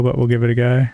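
ordinary talk, nothing marked

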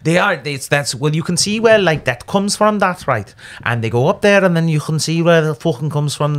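high, lilting Welsh accent moving toward Scouse; sing-song accent. Shown as a demonstration. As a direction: explain it in a Welsh-to-Liverpool lilt. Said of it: "The accent sounds like a song".

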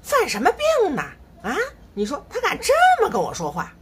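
high, loud imitated snapping retort; son's mock retort. Mother imitating her son snapping at her, high and loud. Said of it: "他还骂我说什么".

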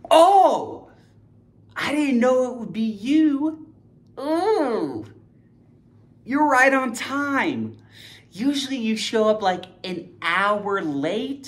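high, breathy surprised gasp. Acted. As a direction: act startled at the door. Acted surprised greeting at door.

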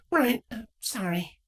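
embarrassed mumbled sorry; sheepish. Acted. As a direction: apologize bashfully. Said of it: "blushed with a sheepish chuckle".

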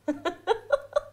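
quiet rising chuckle; light amused laugh; soft laugh. Acted. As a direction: laugh it off gently. Friend teasing about honeymoon.

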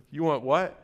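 amused, under-the-breath mock incredulity; incredulous God. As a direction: play an exasperated God softly scoffing at a request. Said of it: "I just imagine God up there".

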